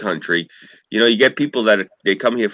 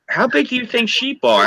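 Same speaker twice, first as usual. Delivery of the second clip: mocking question; 12 semitones higher than usual. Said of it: "they're like".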